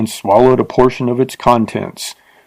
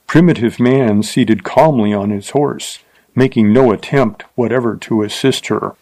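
ordinary delivery, nothing marked

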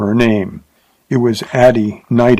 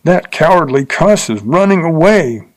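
higher-pitched jeering call, restrained; derisive yell; 6 semitones higher than usual. A narrator's reading. Bill mocks the rider leaping off along the street, derisively. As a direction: jeer it in a raised voice. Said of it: "yelled Bill derisively".

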